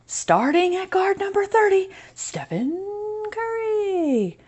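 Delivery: arena announcer call. Acted. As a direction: boom out a player intro like a stadium announcer. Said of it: "in his best crowd screaming voice".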